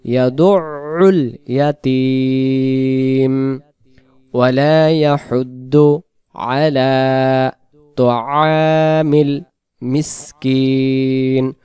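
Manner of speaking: slow, drawn-out tajweed chant; slow melodic Quran recitation. Shown as a demonstration. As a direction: recite it with long stretched vowels. Teacher recites Quran verse.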